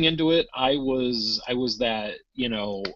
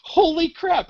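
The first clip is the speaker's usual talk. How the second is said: retold excited disbelief; slower than usual. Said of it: "I'm like".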